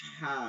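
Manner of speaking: "হা" with a long, forceful throat h; pressed throat articulation. Shown as a demonstration. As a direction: press hard on the h of "হা". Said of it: "চাপ দিয়ে দিয়ে এইরকম করবেন". (গলায় চাপ দিয়ে টেনে "হা" উচ্চারণ)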